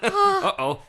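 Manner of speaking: high laughing uh-oh; mock alarm. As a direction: squeak it out in mock panic.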